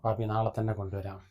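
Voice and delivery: soft, tender comforting; father calming his crying feverish boy; soothing. Acted. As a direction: hush him gently to sleep. Father soothing sick son.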